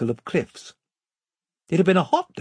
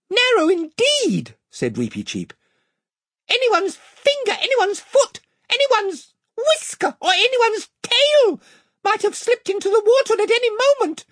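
tiny gallant creature, squeaking; squeaky mouse voice; 4 dB louder than usual. Acted. Reepicheep the mouse speaks.

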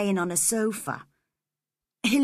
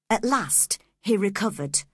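plain reading voice

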